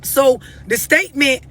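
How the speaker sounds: normal talking voice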